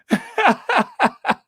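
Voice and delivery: high laughing burst, then trying to settle; over-excited. Real, not acted. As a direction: laugh at yourself getting worked up. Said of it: "look at me getting all excited".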